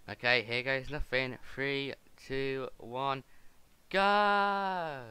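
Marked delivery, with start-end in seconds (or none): 3.9-5.0 loud, drawn-out, high 'go'; big high holler to launch; launch shout. Pretend. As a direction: call out the end of a countdown, stretched. Countdown before jumping into portal.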